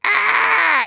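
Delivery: short high exasperated shout; frustrated yell. Retold or imitated. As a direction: burst out in frustration. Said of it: "and I'm just like".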